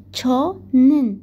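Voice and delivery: each syllable stretched out very slowly; very slow demo. Shown as a demonstration. Teacher spelling out the new ending to students, very slowly. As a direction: drag it out so the ending is clear. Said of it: "برای چو نوشته".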